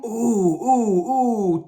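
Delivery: high, hooting monkey ooh; playful monkey calls; monkey hoots. A narrator's reading. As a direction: hoot like a monkey. Monkey sounds in song.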